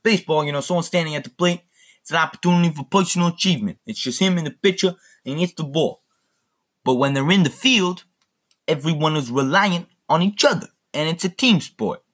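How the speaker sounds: high-pitched mob boss speech; Robert De Niro. Retold or imitated. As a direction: imitate a gangster addressing his men at dinner. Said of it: "It's Robert De Niro".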